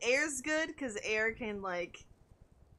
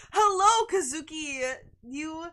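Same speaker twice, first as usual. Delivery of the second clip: excited hello; 7 semitones higher, 11 dB louder, slower than usual. Real, not acted. Greeting a viewer by name.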